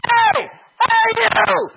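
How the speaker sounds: high, loud calling out; yelling. Retold or imitated. Two guys chasing a man at night, yelling to him. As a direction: yell after a stranger in the dark. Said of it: "yelling to him".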